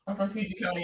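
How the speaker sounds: usual speaking voice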